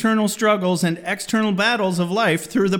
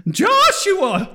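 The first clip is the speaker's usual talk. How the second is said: high, loud mom call of Joshua; shrill motherly call, high and loud; 14 semitones higher, 4 dB louder than usual. Retold or imitated. Podcast host voices his mom scolding him by name. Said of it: "the mom synopsis".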